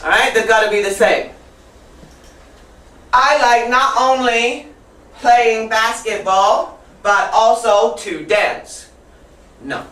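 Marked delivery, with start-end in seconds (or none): 3.1-8.9 slow, spaced-out classroom example; stretched words with gaps between phrases; deliberate model sentence. Shown as a demonstration. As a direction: sound out each phrase slowly for the class.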